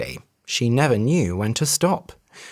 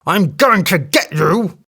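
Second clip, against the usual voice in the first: angry elephant cry; 4 semitones higher, 4 dB louder than usual. A narrator's reading. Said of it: "cried the elephant".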